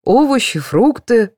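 high-pitched, listing along; chiming in. A narrator's reading. Narrator joining the dentist's list, chiming in. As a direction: pick up the list eagerly. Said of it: "подхватила я".

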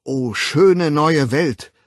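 loud, slow, exalted call; summoning. A narrator's reading. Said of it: "Es war eine Aufforderung". (laut und gedehnt auffordernd)

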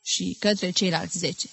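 ordinary delivery, nothing marked

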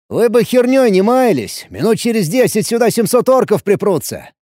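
loud and high warning; loudly spoken. Acted. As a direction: speak up loud over the crowd. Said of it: "громко проговорил я".

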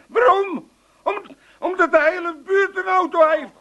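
high squeaky parody of her question; mocking imitation. Father-in-law mocking his daughter's protest, high squeaky mimicry. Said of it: "old man mimics the wife's tone".

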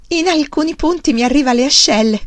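loud, high, thrilled report; proudly showing off the crop, loudly; excited boasting. A narrator's reading.

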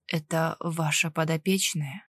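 low, slow voice of a suffering patient; strained question from a sickbed; man in pain. A narrator's reading. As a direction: ask laboriously, low in the chest. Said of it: "его лицо исказилось мукой".

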